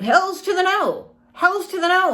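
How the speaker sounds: high, emphatic sassy no; sassy refusal. Acted. Refusing anything in the way of bliss, sassy and high.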